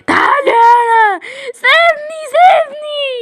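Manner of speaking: high shouted cry to be let go; panicked shout. Acted. Daughter shouts to the giant dad to let her go, panicked. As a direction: scream to be released.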